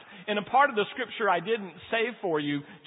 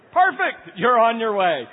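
much higher and louder, playful cheer; mock praise; 4 semitones higher, 10 dB louder than usual. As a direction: cheer them on like a proud coach. Mock praise after teaching verse.

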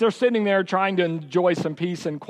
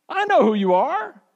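much higher, louder voice of the big pastor; large pastor asking; 10 semitones higher, 4 dB louder than usual. Retold or imitated. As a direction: stop the boy with a searching question. Pastor declares to boy.

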